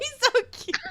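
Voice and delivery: gushing. Real, not acted. Gushing over the child.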